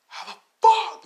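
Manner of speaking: high, loud outburst of fury; raging. Acted. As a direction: explode in an acted rage. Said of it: "He was raging".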